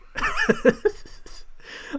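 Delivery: high, breathless cackle; real laughter. Real, not acted. Streamer cracked up by a bad reaction shot, helpless high laugh.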